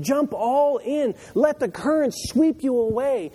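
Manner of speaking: high, drawn-out exhortation; impassioned urging. Acted. Preacher voicing Jesus urging people into the river, impassioned. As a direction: urge them to plunge in, voice pitched well up.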